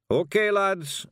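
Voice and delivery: high, loud Scots call to the lads; rally the lads; Rob Anybody shouting. Acted. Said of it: "shouted Rob Anybody".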